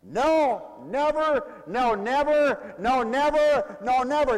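slow, falling stress on each "never"; preacher pounding out "never"; emphatic never. Shown as a demonstration. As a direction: hammer every "never" down. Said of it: "make it an emphatic".

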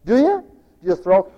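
ordinary delivery

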